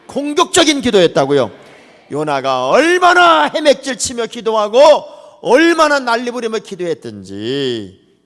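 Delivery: loud, high, driving sermon climax; fiery preaching. Acted. Pastor urges the congregation to desperate prayer, fiery preaching. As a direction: preach it at full force.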